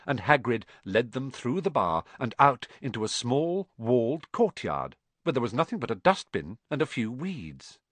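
ordinary delivery, nothing marked

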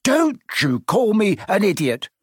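high, indignant retort. A narrator's reading. Neville snaps at Ron over an insult, indignant. Insulted boy answers back.

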